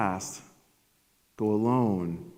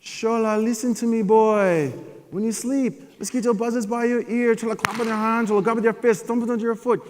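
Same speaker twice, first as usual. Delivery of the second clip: wise dad; 14 semitones higher, 6 dB louder than usual. Retold or imitated. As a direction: hand down wisdom like an old sage.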